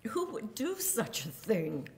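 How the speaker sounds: whispered in disbelief; a stunned whisper to her husband; hushed retold question. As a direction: breathe the question out, barely voiced. Asking husband in shock.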